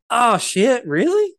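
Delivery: high loud squealing surprise; mock shocked Vanini. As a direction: play fake shock way over the top. Vanini voiced reacting.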